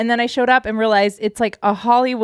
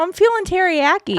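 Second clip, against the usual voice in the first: put-on Baltimore accent; 8 semitones higher than usual. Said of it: "make him say teriyaki all the time".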